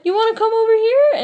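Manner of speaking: put-on high, lilting invitation to a shy kid; coaxing a small child. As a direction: invite a little one over, high and sweet. Coaxing a shy little girl.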